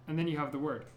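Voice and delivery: normal talking voice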